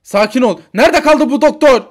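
loud, frantic demand rising in pitch. Acted. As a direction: shout in panic for the doctor. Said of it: "annem babam acayip kafayı yemişler". (panikle bağırarak)

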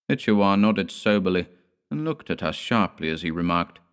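plain reading voice

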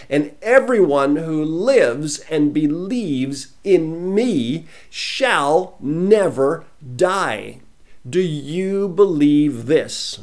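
very slow, loud, stretched words; slow, loud preaching voice drawing out every word; scripture reading. Acted. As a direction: intone it like a solemn proclamation. Reading scripture with solemn weight.

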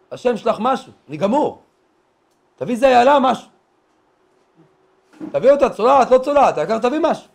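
hungry lion's loud, high plea; roared prayer. Acted. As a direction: beg at full voice, hammed-up desperation. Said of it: "מתחיל לשאוג, שאגות". (תפילת אריה בשאגה מתחננת)

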